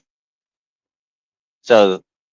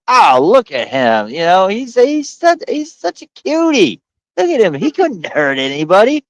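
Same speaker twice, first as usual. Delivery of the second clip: gushing fan; 11 semitones higher than usual. Retold or imitated. Fans gushing over the hidden killer.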